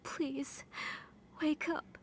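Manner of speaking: rising, breaking plea; desperate plea. Acted. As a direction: beg with mounting desperation.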